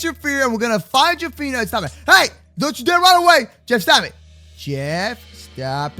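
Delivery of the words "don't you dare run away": ordinary delivery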